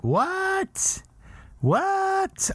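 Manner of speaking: high, squeaky incredulous what; mock the monks' disbelief; hammed-up shocked what. Incredulous Krishnas retold.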